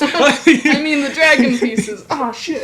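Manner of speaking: laughing through a mock line; giggling mock correction. Continuing mock quote, laughing.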